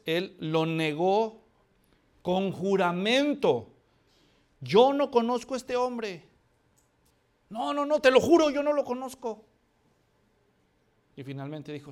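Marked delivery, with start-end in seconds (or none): 4.6-9.4 loud, higher, frantic swearing; panicked denial. Acted. Peter frantically swearing to the crowd that he does not know the man. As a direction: beg them to believe you, voice up high.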